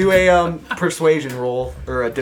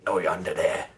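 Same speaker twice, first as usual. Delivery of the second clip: low gruff menace; a lightly voiced gruff one-eyed stranger; 8 semitones lower, 9 dB quieter than usual. A narrator's reading. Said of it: "a gruff voice say".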